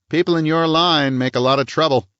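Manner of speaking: sharp, high police chief voice; hard small-town cop, needling; stern police chief. A narrator's reading.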